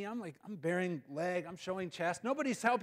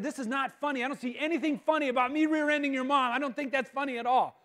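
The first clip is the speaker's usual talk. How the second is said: high, loud indignant protest; 8 semitones higher, 7 dB louder than usual. Retold or imitated. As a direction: insist hotly that nothing is funny. Angry at laughing girlfriend after crash.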